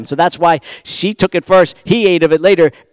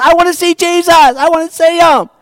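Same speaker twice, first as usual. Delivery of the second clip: much higher, loud, eager plea; a little man desperate to glimpse Jesus; eager Zacchaeus; 11 semitones higher, 5 dB louder than usual. Retold or imitated. Tax collector in the crowd, high and eager. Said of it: "He goes".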